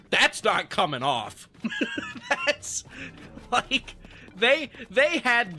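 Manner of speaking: slow, laughing through the words; real laughter over the stuck mouthpiece. Real, not acted. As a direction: crack up while saying it. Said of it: "laughing at the stuck mouthpiece".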